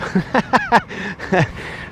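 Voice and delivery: laughter. Real, not acted. Laughs at own joke.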